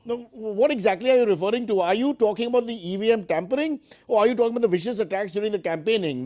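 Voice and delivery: usual speaking voice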